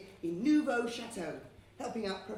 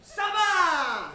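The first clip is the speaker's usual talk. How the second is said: loud high call to the crowd; shouted Ça va; 7 semitones higher, 12 dB louder than usual. Shown as a demonstration. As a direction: rouse the audience with Ça va. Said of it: "I'm gonna shout".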